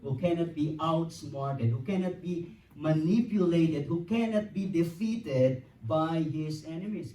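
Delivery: fervent pulpit preaching, building. Real, not acted. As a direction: proclaim it to the congregation. Preaching at full force.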